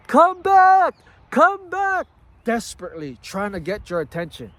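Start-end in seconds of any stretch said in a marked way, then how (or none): 0.1-2.1 shrill high yelling, much louder; the left-behind self yells after the departing train; desperate high yell. Acted. The old self calls after you, yelling high. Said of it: "is at the train stop yelling for you".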